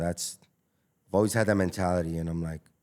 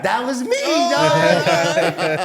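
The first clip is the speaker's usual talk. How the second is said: mock reveal; 11 semitones higher, 9 dB louder, much slower than usual. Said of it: "They're like".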